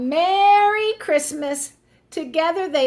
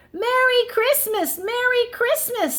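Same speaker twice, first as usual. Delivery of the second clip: excited; 11 semitones higher than usual. Acted. Friends greet cheerfully.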